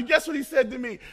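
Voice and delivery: ordinary talk, nothing marked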